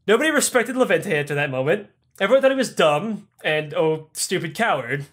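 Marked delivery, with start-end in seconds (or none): none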